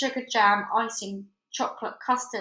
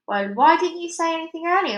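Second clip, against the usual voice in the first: put-on girl voice; 7 semitones higher, 6 dB louder than usual. Girl voice to alien.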